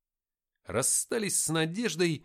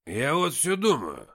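loud, slow tipsy drawl; Никита; 8 dB louder, slower than usual. A narrator's reading. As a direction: drag it out like a drunk starting to philosophise. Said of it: "сильно поддатый Никита". (громко и тягуче, по-пьяному)

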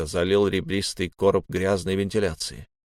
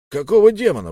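loud, high-pitched protest; indignant outburst; 9 semitones higher, 7 dB louder, faster than usual. A narrator's reading. Old man protesting to the officers, indignant outburst. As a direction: burst out in outrage. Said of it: "возмутился старик".

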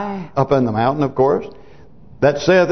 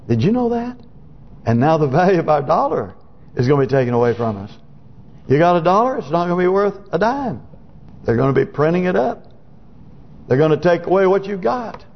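normal talking voice

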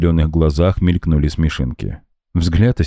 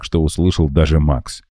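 usual speaking voice

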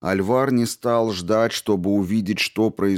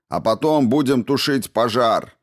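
raised, fervent rallying shout; shouted back; 6 semitones higher, 4 dB louder than usual. A narrator's reading. Man in the mob proclaims holy work, shouting. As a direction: call it out high, like a zealot. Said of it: "крикнул кто-то в ответ".

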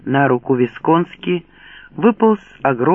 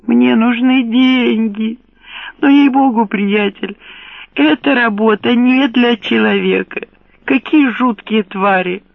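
panicked trapped animal; 8 semitones higher than usual. Acted. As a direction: whine it out, shaking with fear. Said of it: "снова заскулил дрожащим от страха голосом". (испуганный скулёж, голос дрожит)

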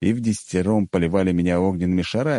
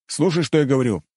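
firm, higher-pitched command; stern; 7 semitones higher, faster than usual. A narrator's reading. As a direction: demand obedience sternly. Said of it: "сурово сказал я". (сурово, с нажимом)

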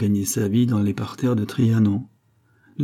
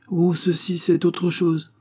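darkened; 8 semitones higher, slower than usual. A narrator's reading. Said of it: "dit la reine assombrie".

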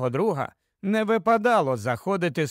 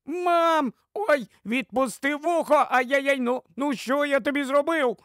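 put-on very high-pitched yelping boy; piercing child's wail; 9 semitones higher than usual. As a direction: protest in pain like a child caught by the ear. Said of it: "а тоді пронизливе". (пронизливий хлопчачий зойк)

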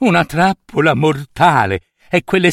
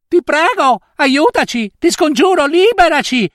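voce alta e lamentosa che implora; streghe supplicanti; 12 semitones higher than usual. Acted. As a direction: supplica da megera in trappola. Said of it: "mi supplicarono quelle megere". (le streghe implorano la nutria, voce acuta e supplichevole)

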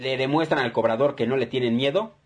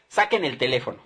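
ordinary delivery

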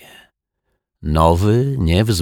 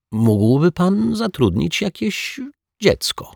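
put-on high, slow, hesitant boy's plea; stammered-out, high-voiced shy request; choked-out request; 13 semitones higher than usual. A narrator's reading. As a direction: force it out haltingly, pitched well up. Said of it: "wykrztusił Dominik".

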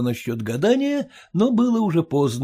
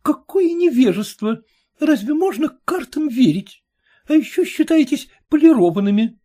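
lightly voiced daughter, higher and disdainful; scornful daughter; 9 semitones higher than usual. A narrator's reading. Daughter scolding her mother over cards, high and scornful.

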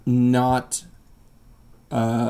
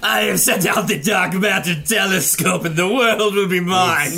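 slow, higher villain gloat; evil scientist; 5 semitones higher than usual. Retold or imitated. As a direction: gloat like a cackling mad scientist. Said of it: "sent out by a doc, like, an evil scientist".

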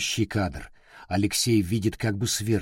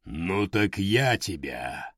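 slow, lower, menacing giant voice; inhuman stone giant; 3 semitones lower, 3 dB quieter, slower than usual. Acted. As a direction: threaten like a stone colossus. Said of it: "нечеловеческий голос".

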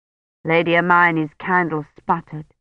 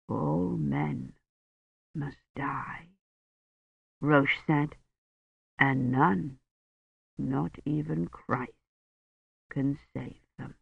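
slow, much softer, thick-tongued words; blurred dying voice; 3 semitones lower, 11 dB quieter, slower than usual. A narrator's reading. Dying Roche speaks to Kivrin, slow, soft and blurred. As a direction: speak through a swollen tongue.

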